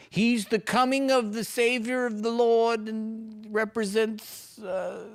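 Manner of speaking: high, slow, hammed-up preacher voice, mocking the clip; squeaky mock-sermon voice; mocking pious voice.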